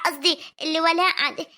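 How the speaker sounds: usual speaking voice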